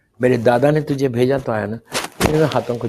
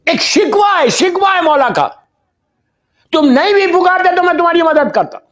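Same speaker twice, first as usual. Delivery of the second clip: high, loud urgent sermon voice; impassioned preaching; 13 semitones higher, 4 dB louder than usual. Acted. Preacher rebuking his congregation, impassioned high loud preaching. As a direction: plead with the crowd at full force.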